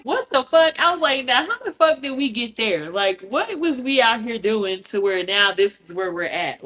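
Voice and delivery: shrieking shock fading into baffled questioning; shocked disbelief. Retold or imitated. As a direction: recoil in total disbelief.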